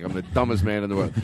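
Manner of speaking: usual speaking voice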